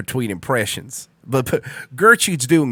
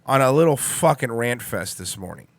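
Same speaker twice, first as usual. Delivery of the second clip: normal talking voice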